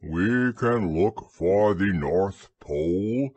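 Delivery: low, slow, ponderous donkey; deep, drawn-out grumble; grumbling Eeyore. A narrator's reading. Donkey tells everybody his view, low slow grumble.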